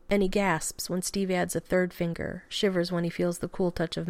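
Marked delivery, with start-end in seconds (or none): none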